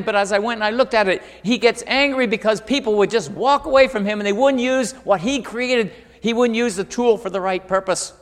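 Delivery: high, loud, emphatic preacher's voice; full-force preaching. Acted. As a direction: punch out each point to the congregation.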